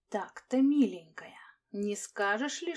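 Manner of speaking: slow menacing whisper; hissing whisper. Acted. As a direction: hiss it in a whisper, threatening. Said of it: "пониженным до шёпота шипящим голосом".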